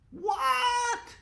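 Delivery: very high shocked yelp; shocked shout. Acted. Class hearing the seat caught fire, shocked high yelp. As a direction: shriek it in disbelief. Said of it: "we all shouted".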